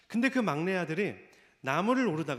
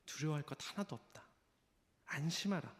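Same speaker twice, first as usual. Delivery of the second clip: gentle reassurance; 10 dB quieter than usual. Acted. Jesus reassuring the frightened father.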